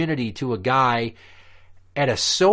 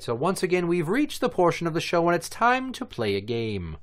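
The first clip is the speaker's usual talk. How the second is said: game show host; 7 semitones higher than usual. Acted.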